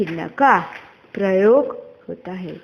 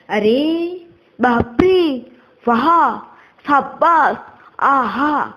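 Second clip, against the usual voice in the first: higher-pitched mock surprise; demonstrated exclamations; 4 semitones higher, slower than usual. Shown as a demonstration. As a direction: exclaim each one separately, pitched up. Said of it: "आदि भावों प्रकट करने के लिए".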